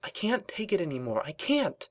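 high, contained desperate plea; urgent desperation. A narrator's reading. Twilight confides in Rarity at work, contained desperation. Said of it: "she hissed urgently".

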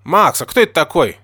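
high, loud puzzled question; surprised. A narrator's reading. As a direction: ask in astonishment about the stranger. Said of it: "с удивлением спросила Эпплджек".

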